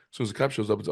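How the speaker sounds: normal talking voice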